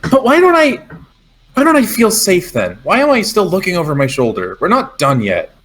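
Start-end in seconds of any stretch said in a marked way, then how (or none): 0.1-4.6 urgent, high-pitched worry; mildly distressed, pitch starting high and settling; anxious questioning. Acted. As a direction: plead for an answer, on edge. Character anxiously questions why safety hasn't come.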